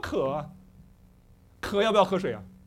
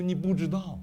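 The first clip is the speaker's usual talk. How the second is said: normal talking voice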